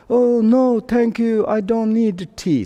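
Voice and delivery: put-on high-pitched old man's refusal; old village guy. Old village guy declining what he misheard, high elderly voice. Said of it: "there is one old guy".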